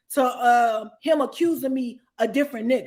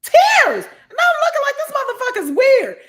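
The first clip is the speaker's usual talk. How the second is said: loud, high, disbelieving outburst; can't believe it, yell it; telling the host he really cried, loudly incredulous; incredulous shout; 11 semitones higher, 8 dB louder than usual. Real, not acted. Incredulous retelling of boyfriend crying.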